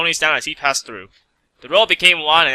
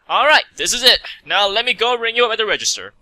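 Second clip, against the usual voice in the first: lightly voiced refined lady, higher pitch; a genteel shopkeeper lilt, pitched up; Rarity voice; 7 semitones higher than usual. Rarity ringing up a customer, genteel lilt.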